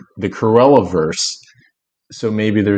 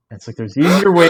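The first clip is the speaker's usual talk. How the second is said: laughing; 6 semitones higher than usual. Real, not acted. Laughing through the joke.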